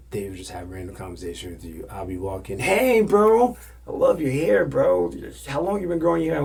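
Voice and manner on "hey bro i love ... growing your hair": high, loud mock enthusiasm; gushing fan. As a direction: imitate an overexcited bro gushing. Voicing overeager admirer of his hair.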